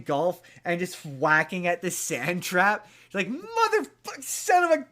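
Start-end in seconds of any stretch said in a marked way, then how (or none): 3.3-4.9 much higher, loud strangled mock curse; frustrated outburst. Con man raging at the sand trap, high mock yell. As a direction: yell it like a botched golf shot.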